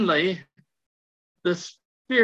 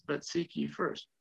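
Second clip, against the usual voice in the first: soft, hushed inner voice; quiet voice; 4 semitones lower, 12 dB quieter than usual. Retold or imitated. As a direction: say it like a still small voice. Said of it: "this real quiet voice says".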